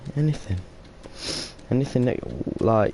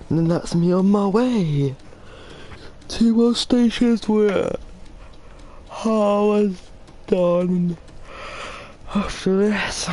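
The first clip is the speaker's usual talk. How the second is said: high playful voice; 9 semitones higher, slower than usual. Pretend. Playful high voice on train run.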